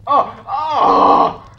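loud, high yelp of horror; shocked cry. Real, not acted. Player horrified by the game, shocked loud cry. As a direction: cry out loudly, voice jumping up.

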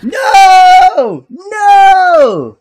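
anguished high scream; raging gamer. Real, not acted. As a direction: scream it out in despair. Gamer killed again.

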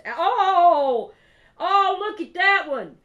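loud, high-pitched burst of delight; real eardrum-busting excitement; excited squeal. Real, not acted. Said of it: "I hope I didn't bust y'all's eardrums".

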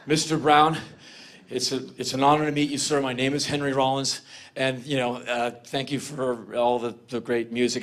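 low, stumbling, trailing into filler words; halting nervous greeting. Retold or imitated. Fan finally meeting [name], halting, low greeting. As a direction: fumble the practised introduction, low and hesitant. Meeting his idol, fumbling rehearsed lines.

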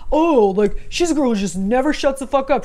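lightly voiced sneering boyfriend; mock a guy brushing her off; mocking dismissive guy. Imitating a gaslighting guy dismissing her, sneering. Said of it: "guys wanna gaslight you and say".

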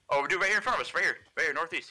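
excited callout. Real, not acted. Spotting an enemy, excited callout.

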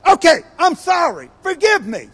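high, loud, grudging apology; insincere angry apology. Retold or imitated. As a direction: shout sorry while still mad. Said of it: "You don't sound like you're sorry".

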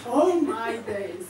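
ordinary talk, nothing marked

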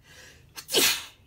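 a real sudden sneeze; sneezing. Real, not acted. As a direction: sneeze out loud. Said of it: "Sorry, I'm gonna sneeze".

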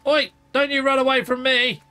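calling out. Real, not acted. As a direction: shout it after the runaway. Chasing a fleeing creature.

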